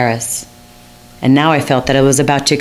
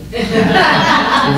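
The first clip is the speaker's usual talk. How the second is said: laugh; 9 semitones higher than usual. Real, not acted. She laughs at Romeo's remark.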